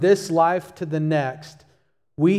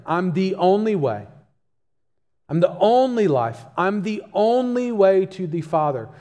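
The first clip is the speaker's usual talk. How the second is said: weight on "only" each time, every line falling firmly; emphatic; 3 semitones higher than usual. Acted. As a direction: hit "only" hard in each phrase. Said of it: "He says it emphatically different".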